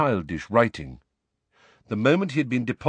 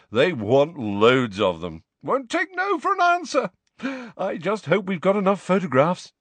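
vain celebrity voice; 9 semitones higher, 3 dB louder than usual. Acted. Lockhart voice.

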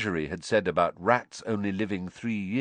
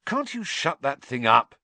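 raised, irritated snap; furious; 5 semitones higher, 5 dB louder than usual. A narrator's reading. Boy complaining to friend about whistling gadget, furious. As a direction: snap it loudly, still angry. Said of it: "said Ron furiously".

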